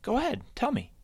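chirpy bunny egging her on; coaxing Sam. A narrator's reading. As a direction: coax her to talk. Happy Sam coaxing Sally.

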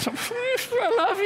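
high, slow, teary mock sob; imitating crying. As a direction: blubber it like a soppy dad. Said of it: "I'm crying".

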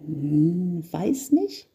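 very high, timid little voice; hum, then a small bashful answer; shy small boy. A narrator's reading. Smallest elf unsure what to wish for, shy murmur. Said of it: "flüsterte der Junge".

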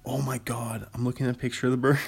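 ordinary delivery

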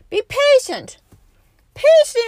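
loud, high exhortation; preacher's sudden exhortation; exhorting. Acted. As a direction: urge them to be patient.

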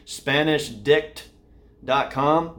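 slow, careful repeat of the web address; carefully spaced out for podcast listeners; slow and clear. Shown as a demonstration. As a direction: say each word slowly so listeners catch it. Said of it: "If you cannot hear that's what that is".

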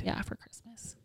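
hushed unvoiced whisper. Real, not acted. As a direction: whisper it like a secret. Secret kept from husband.

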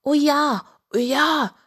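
eager pig calling out. A narrator's reading. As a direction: call out keen agreement as a pig. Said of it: "rief das Schwein".

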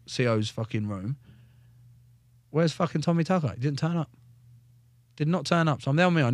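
ordinary delivery

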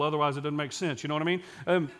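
ordinary talk

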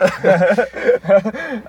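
loud high laugh. Real, not acted. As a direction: burst out laughing. Laughs at own joke.

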